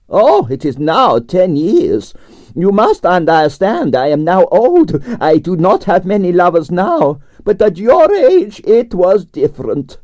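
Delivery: put-on high, theatrical Russian accent with drawn-out vowels; grand Russian ballerina complaining about her night; Russian prima ballerina. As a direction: play the dramatic Russian diva. Arienskaya held voice.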